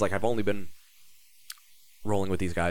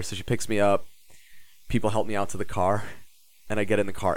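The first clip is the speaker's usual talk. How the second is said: ordinary talk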